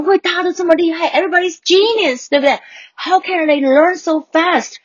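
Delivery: high, disbelieving inner voice; a child stunned in her first English class, high and disbelieving; amazed inner voice. Retold or imitated. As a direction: wonder aloud how they all got so clever. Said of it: "我想，怎么会大家都这么厉害".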